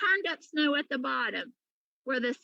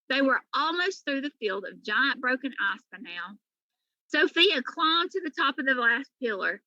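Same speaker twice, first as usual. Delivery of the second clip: plain reading voice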